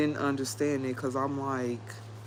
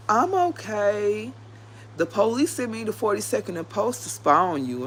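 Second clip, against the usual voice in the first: lightly voiced odd inmate; 7 semitones higher than usual. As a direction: play the girl as oddly serious and higher. Voicing the other inmate's reply.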